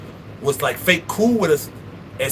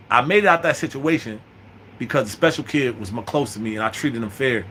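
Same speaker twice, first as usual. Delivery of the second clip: ordinary talk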